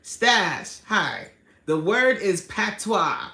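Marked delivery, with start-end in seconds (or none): 2.4-3.3 patois said slowly as PAT-wah; pronouncing patois. Shown as a demonstration. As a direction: stretch patois into two clear syllables, pat-twah. Teaching chat the word.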